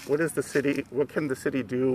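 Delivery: ordinary talk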